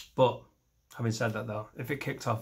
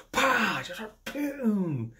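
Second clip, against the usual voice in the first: punch noise; 8 semitones higher, much slower than usual. Pretend. Punch sound effect.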